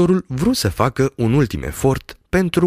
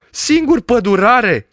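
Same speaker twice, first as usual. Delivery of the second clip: alarmed; 10 semitones higher, 5 dB louder, slower than usual. Acted. Doctor dismayed at being left alone.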